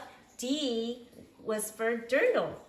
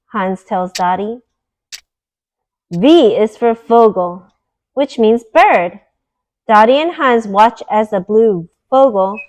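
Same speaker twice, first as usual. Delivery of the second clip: bright, clear storybook read-aloud voice; louder, lively storyteller reading to little ones; reading to children; 18 dB louder than usual. Acted. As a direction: read it like a bedtime picture book for kids. Reads picture book aloud.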